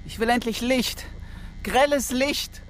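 high-pitched anguished demand in the dark; desperate plea for light. Acted. Clemens demands light in darkness.

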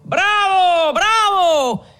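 very high, sustained jeering cheer; mocking cheer. A narrator's reading. Companions guffawing at Renzo, high mocking cheer. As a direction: cry it out like rowdy companions. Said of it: "gridarono sghignazzando più forte i compagnoni".

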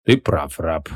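low grumbling master; grumbled. A narrator's reading. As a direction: mutter it down low to the servant. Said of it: "буркнул Креол".

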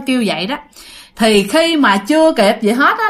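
ordinary delivery

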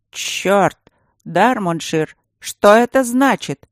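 put-on loud, rising drunken outrage; shocked shout. A narrator's reading. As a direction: bark it out in shock. Drunk prince shocked, demanding.

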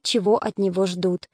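ordinary delivery

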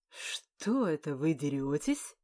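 slow stunned disbelief; dazed and slowed down after a slap. Acted.

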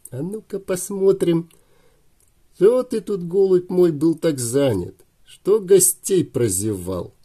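high, slowing sneering taunt; mocking thug voice. A narrator's reading. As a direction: taunt the captive, drawing it out. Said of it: "сказал Петька".

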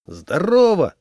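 loud, high, hearty greeting; shout into phone. A narrator's reading. Semyon phoning the groom, shouting hello. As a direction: call down the phone line. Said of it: "крикнул он в трубку".

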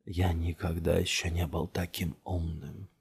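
slow, hushed, ghostly echo; like a voice heard inside the head; entranced murmur. A narrator's reading. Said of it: "услышала она голос Джамила". (медленно, тихо, заворожённо)

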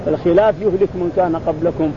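ordinary delivery

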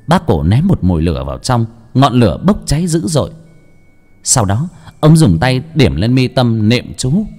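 ordinary delivery

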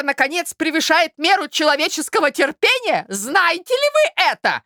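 high-pitched indignant tirade; exasperated outcry. A narrator's reading. Suitor protests her innocent air, exasperated. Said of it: "вскричал озадаченный Мозгляков".

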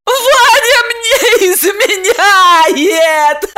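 very high, loud drawn-out howl; squealing, sobbing woman's lament; grief-stricken wailing. A narrator's reading. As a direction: wail each word in misery. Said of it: "горестно провыла".

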